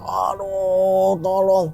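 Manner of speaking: drawn-out, wailing cry for help; stretched-out pleading wail; mock yelling. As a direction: re-enact crying out in pain. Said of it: "saya teriak-teriak".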